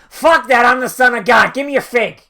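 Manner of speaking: loud, indignant shout; mock angry yell. Jesus demanding a fig out of season, mock indignant yell. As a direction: bellow it like an entitled king. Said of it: "Jesus goes".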